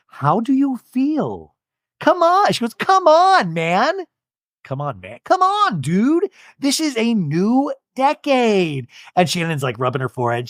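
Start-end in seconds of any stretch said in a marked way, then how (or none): 0.1-8.9 pushy cheerleading. Retold or imitated. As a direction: hype her up like a cheerleader. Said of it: "And Heather goes".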